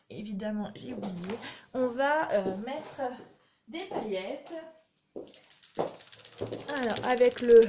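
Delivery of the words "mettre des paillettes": usual speaking voice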